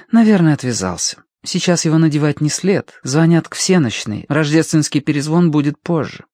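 calm big sister; older sister voice. A narrator's reading. Rosamund voiced.

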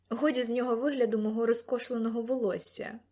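plain reading voice

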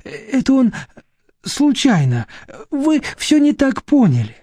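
high-pitched, halting explanation; flustered excusing. Acted. Vera excusing her husband to the inspector, flustered.

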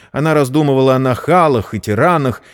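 ordinary delivery, nothing marked

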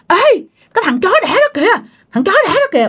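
high-pitched hostile call-out; acted furious cursing. Possessed aunt spotting dad and lunging, acted fury. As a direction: curse him as you charge at him.

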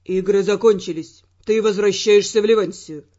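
low male voice, stern; stern Ferrant. Acted. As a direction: turn it back on her.

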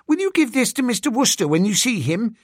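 put-on high, quick child's voice; eager schoolgirl. Schoolgirl handing the valet a cigarette case, eagerly. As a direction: rattle it off high like a young girl.

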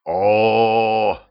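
a drawn-out groaning «О». A narrator's reading. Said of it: "простонал дед". (стонущее «О»)

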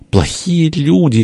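high, drawn-out plaintive cry. A narrator's reading. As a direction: call it high and stretched, wronged. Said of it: "крикнул из четвёртого". (высокий протяжный выкрик)

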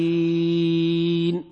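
نستعين stretched for six beats, very slow and chanted; six-count madd with ishmam. Shown as a demonstration. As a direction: hold نستعين at full length and finish with silent rounded lips. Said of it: "بحركتين أو أربع أو ست".

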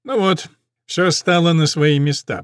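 ordinary delivery, nothing marked

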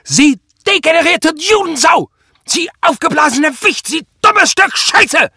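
very high, very loud furious screaming; screaming rage. A narrator's reading. Enraged superior abuses his officer for releasing a suspect, screaming. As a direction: scream abuse at the top of your voice. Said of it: "schrie Prall wie am Spieß".

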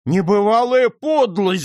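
high, loud cry of indignation; loud acted outrage, pitch leaping up; outraged shout. A narrator's reading. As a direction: shout it out, scandalised. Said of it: "закричал нотариус".

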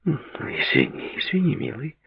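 very soft, slow apology; gently calm an irritated partner; hushed, placating tone; soothing. A narrator's reading. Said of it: "успокаивающе произнесла Клаудия".

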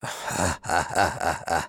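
a zombie laughing it off. A narrator's reading. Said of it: "وهو يضحك".

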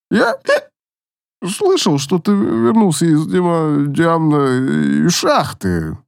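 put-on slurred drunk. Drunk elf stumbles over words.

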